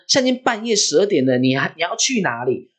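high, fast, pressing question; exasperated midnight questioning, acted out; retold incredulous father. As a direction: play a dad demanding an explanation. Father challenges his sleepwalking child.

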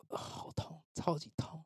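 breathy whispered moan of pain; fake pained whisper. Teller fakes pain to scare waiting patients, whispered moan. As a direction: pretend it hurts, whisper it very softly.

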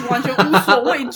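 laughing while telling it; amused, laughing through the words. Real, not acted. Kids fearlessly sliding down. (边笑边说)

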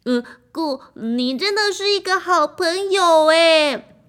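the orchestra praising the drum as a friend, high and loud; grateful friend. A narrator's reading. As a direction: thank the drum with delight.

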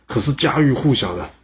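ordinary delivery, nothing marked

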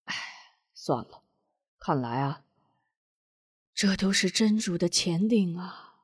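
tearful sigh. A narrator's reading. Han Ping to Madam Wu, tearfully resigned. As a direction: give up with a heavy heart. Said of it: "双眼含泪道".

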